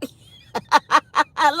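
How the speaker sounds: laughing out loud. Real, not acted. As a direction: burst out laughing. Laughing at a comment.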